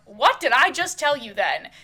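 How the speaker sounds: loud, cross challenge; angry demand. Acted. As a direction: demand it loudly like a fed-up partner. Acted quarrel, demanding an answer.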